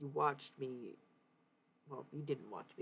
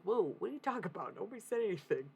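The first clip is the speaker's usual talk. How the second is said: taken aback; 5 semitones higher, 5 dB louder, faster than usual. Retold or imitated. Acting out reply to own outburst.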